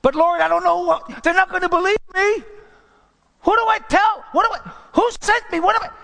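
high, loud anxious plea; a frightened Moses protesting to God; pleading Moses. Retold or imitated. Moses pleading with God in a high, anxious voice. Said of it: "when he spoke to Moses".

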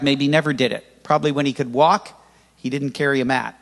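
ordinary talk, nothing marked

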